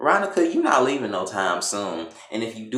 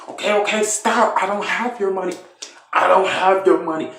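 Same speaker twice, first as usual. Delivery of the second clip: imitated panicked pleading; 8 semitones higher than usual. [name] to Candace mid-beating, high mock panic.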